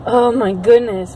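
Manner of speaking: ordinary talk, nothing marked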